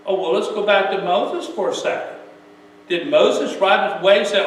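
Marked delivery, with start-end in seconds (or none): none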